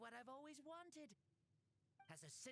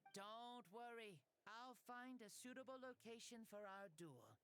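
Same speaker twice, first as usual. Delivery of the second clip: ordinary delivery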